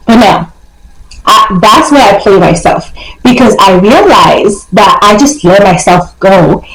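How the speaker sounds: normal talking voice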